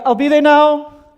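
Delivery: high, loud, sustained call; calling back. Acted. Husband tells wife he's coming, calling back. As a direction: holler back to the other room. Shouts back across the house.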